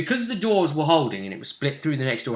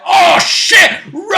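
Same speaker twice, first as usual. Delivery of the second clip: acted full-volume yell, pitched way up; panicked shout; 11 dB louder, slower than usual. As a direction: scream the alarm to flee, hammed-up. Acts out party fleeing slime.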